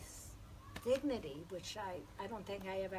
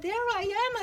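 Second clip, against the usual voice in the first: excited retelling; 13 semitones higher, 10 dB louder than usual. Retold or imitated. Retells the meeting.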